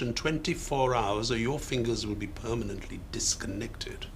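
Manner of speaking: hissed under the breath, cold and low; menacing whisper. Acted. Gangster whispering a threat to the shop owner, menacing whisper.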